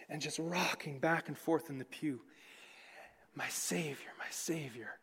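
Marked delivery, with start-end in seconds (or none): 3.3-5.0 whispered prayer. Retold or imitated. Old woman overwhelmed by film.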